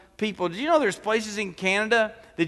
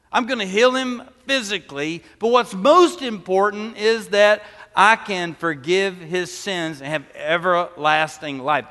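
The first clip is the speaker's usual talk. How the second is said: ordinary talk, nothing marked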